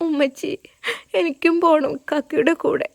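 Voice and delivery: put-on a small girl begging to go along; crying child. Little girl begging her mother to follow Safeer, mild tearful voice. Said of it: "Ami കരഞ്ഞു".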